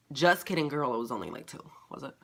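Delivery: normal talking voice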